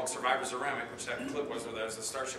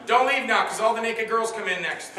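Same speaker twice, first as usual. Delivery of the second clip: much louder, higher shout to the room; joking call-out; 8 semitones higher, 10 dB louder than usual. Pretend. Speaker to audience members leaving his talk, jokingly calling out. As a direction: call after people walking out, teasing.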